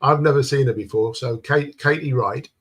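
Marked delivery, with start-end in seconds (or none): none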